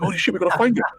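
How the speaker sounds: high-pitched panicked urgency; frantic retold exclamation; retold freaking out. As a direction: act out an alarmed call to action. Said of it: "I was really freaking out".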